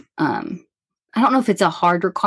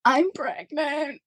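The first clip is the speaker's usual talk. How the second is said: tearful confession; 8 semitones higher, 5 dB quieter, much slower than usual. Retold or imitated.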